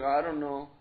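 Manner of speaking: low, listless mumbled reply; sluggish youth. Acted. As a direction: play a youth with no energy. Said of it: "They're always sluggish".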